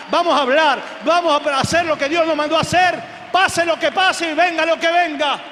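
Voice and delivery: loud, high, driving exhortation; rally the church with punched, repeated phrases; fervent shouted sermon; full-force preaching. Real, not acted.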